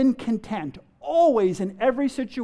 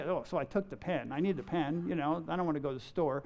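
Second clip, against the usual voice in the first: low, soft, dismissive self-justifying; mumbled minor excuse, acted out; offhand excuse; 5 semitones lower, 10 dB quieter, faster than usual. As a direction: play it down quietly, as if it is nothing. Excusing taking a pen from work.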